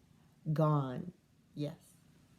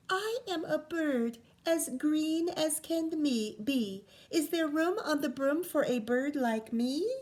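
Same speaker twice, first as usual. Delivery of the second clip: bird voice; 7 semitones higher than usual. A narrator's reading. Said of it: "then said".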